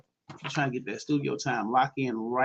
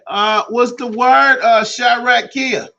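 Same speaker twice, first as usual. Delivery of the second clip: higher, louder, drawn-out shout-out; hype shout-out; 8 semitones higher, 11 dB louder, slower than usual. Acted. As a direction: call the name out big and slow. DJ shouting out a listener.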